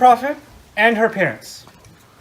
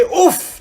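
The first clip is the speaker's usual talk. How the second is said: exasperated oof sigh, a little higher; frustrated oof; 9 semitones higher, 3 dB louder than usual. Shown as a demonstration. Teacher showing kids the sigh to avoid. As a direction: let out a fed-up oof. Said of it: "Don't say".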